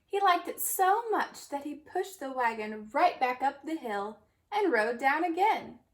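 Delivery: usual speaking voice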